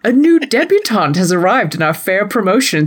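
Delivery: formal society gossip columnist narration; mannered, ceremonious announcement of juicy news; Lady Whistledown. Acted. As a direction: read it like a period scandal sheet. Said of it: "Lady Whistledown's match report".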